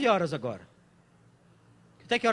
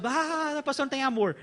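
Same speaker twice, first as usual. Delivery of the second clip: higher-pitched imitated wail of complaint; mock offended mourner; 5 semitones higher than usual. Bereaved person complaining about the pastor, mock-offended. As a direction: protest in a hammed-up, high voice.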